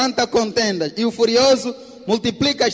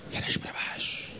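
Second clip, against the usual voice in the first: hushed ominous whisper; whispered warning; 15 dB quieter than usual. Real, not acted. Interpreter whispering the warning to the church.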